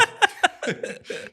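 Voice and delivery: airy unvoiced laughter; breathy laugh. Real, not acted. Host still laughing at his mic mistake.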